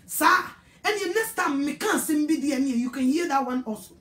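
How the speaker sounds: usual speaking voice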